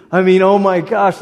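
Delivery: ordinary talk, nothing marked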